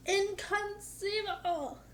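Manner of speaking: high, slow, drawn-out "Inconceivable!"; mock outraged Vizzini. Quoting Vizzini's catchphrase.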